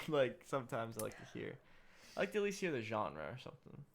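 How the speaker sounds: normal talking voice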